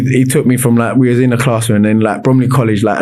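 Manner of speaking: ordinary talk, nothing marked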